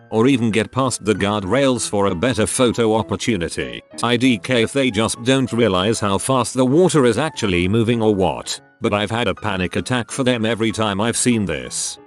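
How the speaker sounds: ordinary delivery, nothing marked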